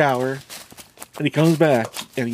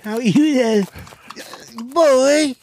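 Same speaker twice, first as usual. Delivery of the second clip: mocking priest; 11 semitones higher, slower than usual. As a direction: squeal it up high as a mock priest. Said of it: "That's what they do".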